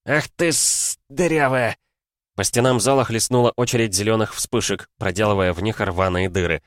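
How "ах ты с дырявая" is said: higher, choked-off swearing; strangled cursing. A narrator's reading. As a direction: curse through a constricted throat, pitched up. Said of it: "Послышался сдавленный мат Серёгина". (сдавленная ругань)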